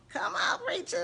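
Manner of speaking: excited cheer. Real, not acted. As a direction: shout it up high like hyping a friend at church. Cheering on her preacher friend.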